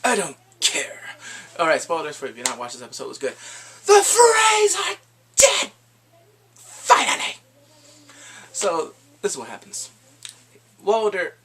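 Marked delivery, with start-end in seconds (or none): 3.9-5.7 high, slow, loud triumphant cry; gleeful drawn-out cheer; excited shout. Real, not acted. Cheering a villain's death. 6.5-7.4 relieved cry. Real, not acted. Reacting to the Freys dying.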